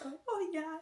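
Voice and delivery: retold realization. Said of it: "So I was like".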